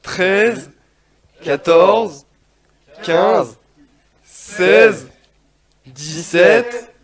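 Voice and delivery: strained count building to a shout; doing push ups. Acted. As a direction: push through the final reps, yelling.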